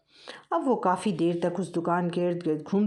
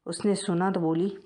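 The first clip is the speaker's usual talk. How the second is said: ordinary delivery, nothing marked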